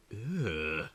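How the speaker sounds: ordinary delivery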